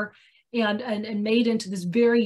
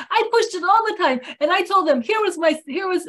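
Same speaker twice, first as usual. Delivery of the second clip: emphatic retold boast, pitched up; insistent Greek businessman; 6 semitones higher, 4 dB louder than usual. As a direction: imitate a Greek salesman, higher and louder. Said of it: "I can't do his accent".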